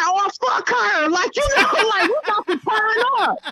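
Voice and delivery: high, laughing imitation of rowdy boys; defiant kids. Boys ignoring mother in another room, high gleeful defiance.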